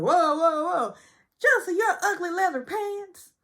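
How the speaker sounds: high, loud, teary outburst; high, loud mock wail; mock shouting. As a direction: yell it high through mock tears. They try to stop her.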